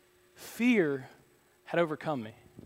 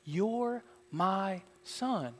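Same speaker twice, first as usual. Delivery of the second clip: imitated divine whisper; 4 semitones higher, much slower than usual. God repeating to His son, slow hushed declaration. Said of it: "Spirit of God whispering that to you".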